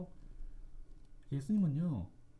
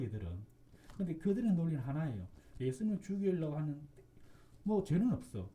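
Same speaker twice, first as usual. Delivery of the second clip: ordinary talk, nothing marked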